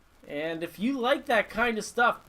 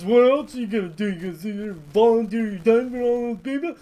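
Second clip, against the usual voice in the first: louder nagging wife voice, put-on; mocking the old lady; 3 semitones higher, 8 dB louder than usual. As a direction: scoff at him like a nagging wife. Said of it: "and she's".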